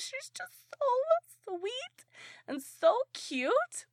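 high, drawn-out cooing; high-pitched, slow gush; gushing adoration. Real, not acted. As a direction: coo over something adorable, slowly. Gushing over a cute character.

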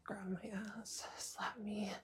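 ordinary talk, nothing marked

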